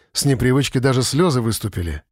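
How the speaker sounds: plain reading voice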